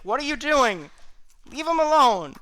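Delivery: put-on high-pitched, indignant kid's protest; unhappy halfling kid. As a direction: scold them as an upset child, pitched right up. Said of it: "he is not happy with this".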